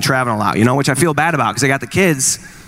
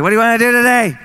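put-on kid voice; 8 semitones higher than usual. Mimicking a kid waking up.

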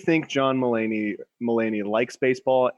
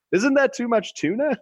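higher, awkward asking voice; awkward question; 11 semitones higher than usual. Retold or imitated. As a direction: ask it with mock awkward surprise. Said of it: "can awkwardly be asked".

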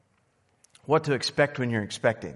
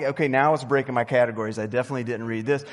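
rushed retold panic; 3 semitones lower, much faster than usual. Retold panic in the delivery room.

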